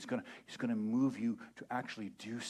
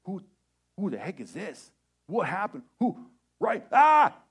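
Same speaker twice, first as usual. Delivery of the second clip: higher, louder panicked questions ending in a yelp; startled panic; 7 semitones higher, 13 dB louder than usual. Pretend. You seeing an angel in your room, panicking. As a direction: freak out at the intruder.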